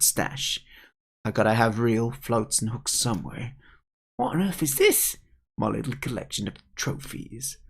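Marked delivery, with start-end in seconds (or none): 4.2-5.2 high, put-on kid voice; astonished young character. Kid discovering the pike's stash, astonished. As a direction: ask it high, taken aback.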